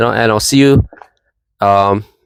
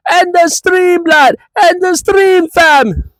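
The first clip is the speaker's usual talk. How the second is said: very high mock Jamaican yell; mock Jamaican shout; slower than usual. Vlogger mimics a rival fan yelling in a mock Jamaican accent. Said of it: "How's that Jamaican accent for me".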